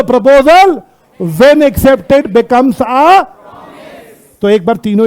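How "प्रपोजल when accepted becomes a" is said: very slow, stretched-out words; emphatic word-by-word recital; slow prompt. Shown as a demonstration. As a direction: draw out the last word for the class to finish. Class made to repeat the definition.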